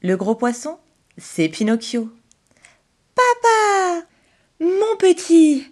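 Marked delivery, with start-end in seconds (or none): 3.1-4.1 put-on high, bright cry of a boy finding his father; joyful cry. Pinocchio retrouve son père, cri de joie aigu. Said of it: "s'exclame Geppetto". 4.6-5.7 high, slow, drawn-out cry of relief; moved exclamation. Acted. Geppetto hugs his son, slow overjoyed cry. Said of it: "s'exclame Geppetto".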